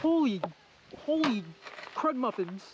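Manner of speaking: amazed, trailing off; awed exclamation. Real, not acted. Awed by alligator.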